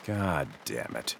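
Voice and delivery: low, slow grumbling curse; muttered curse. Acted. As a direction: swear under your breath, fed up. Annoyed driver gets text.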